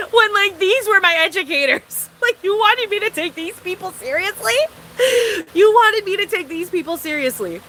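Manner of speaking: can't believe it, breaking into laughter; laughing. Real, not acted.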